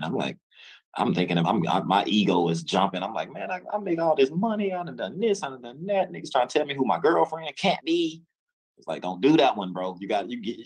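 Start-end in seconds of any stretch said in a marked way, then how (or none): 3.3-8.2 higher retold boastful defiance; imitating his own puffed-up ego; mock boastful complaint; cocky ego rant.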